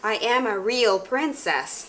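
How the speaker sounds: slow, proud declaration; proud princess. Acted. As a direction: claim it proudly.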